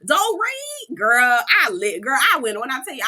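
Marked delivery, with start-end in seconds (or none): 0.1-1.4 high, slow, drawn-out cheer; delighted high cheer; excited cheer. Retold or imitated. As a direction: stretch out her name in delight. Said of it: "I got up and went to screaming".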